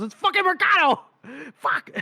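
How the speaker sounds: high-pitched panicked outburst, acted; freaking out. Spotting the captive through the eyeglass, freaking out. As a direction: shout it in sudden mock alarm, voice shooting up. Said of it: "now they're freaking out".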